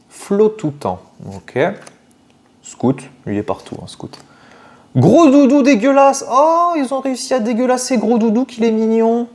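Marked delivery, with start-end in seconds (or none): 4.9-9.3 high wailing fuss over something cute; high-pitched dismay. Real, not acted. Unboxer fussing over a spoiled cute card, high wail. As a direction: lament it in a high, put-on squeal.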